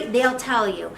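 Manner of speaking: normal talking voice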